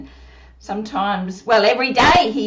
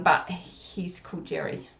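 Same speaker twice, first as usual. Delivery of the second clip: whisper; 8 semitones lower, 8 dB quieter than usual. Acted. Puppet whispers aside.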